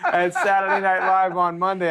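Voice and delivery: ordinary talk, nothing marked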